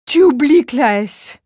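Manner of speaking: damn the science that steals him; despairing curse. Acted. Wife curses his science in despair.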